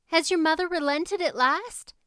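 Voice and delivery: put-on high, hopeful young girl; eager girl. A narrator's reading. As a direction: ask it brightly. Anne voiced in story.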